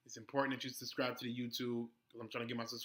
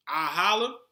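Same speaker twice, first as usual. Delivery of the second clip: loud sign-off catchphrase; 4 semitones higher, 11 dB louder than usual. Real, not acted. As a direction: sing out the goodbye.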